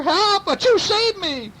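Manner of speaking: very high, squealed plea; burglar's yell. A narrator's reading. Burglar cries to be saved from cats, acted panic. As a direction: sneeze between acted cries for help. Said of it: "yelled the burglar".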